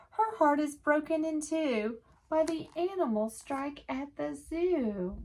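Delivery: lively picture-book read-aloud in rhyme; sing-song storytime voice for little listeners; reading to children. A narrator's reading. Teacher reading a rhyming book to children, lively.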